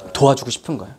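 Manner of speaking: ordinary talk, nothing marked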